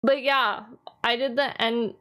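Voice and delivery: usual speaking voice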